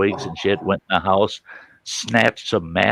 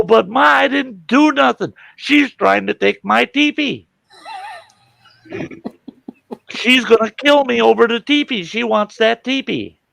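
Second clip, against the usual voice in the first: high, loud mock-whiny boy; pleading kid; 4 dB louder than usual. Boy defending himself to his mother, pleading. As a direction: protest to Ma in an imitated child's falsetto.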